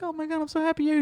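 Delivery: hammed-up happy squeal of welcome; mock overjoyed girlfriend. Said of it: "He's like".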